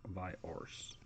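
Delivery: a soft, secretive whisper of Viars. Shown as a demonstration. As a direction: breathe Viars out in a hushed whisper.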